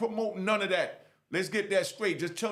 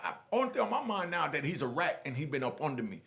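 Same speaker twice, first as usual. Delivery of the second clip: ordinary talk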